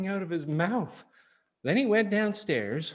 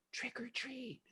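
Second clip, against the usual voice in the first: whispered, much quieter; very softly; 7 semitones higher, 17 dB quieter than usual. Acted. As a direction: breathe it out in a whisper. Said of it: "he said very softly".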